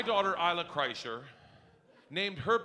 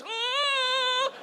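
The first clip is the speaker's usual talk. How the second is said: long, very high "ah" of wonder; mock hopeful gasp. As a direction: imitate an awestruck child's drawn-out cry. Imitates child's hopeful look.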